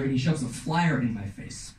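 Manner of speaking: normal talking voice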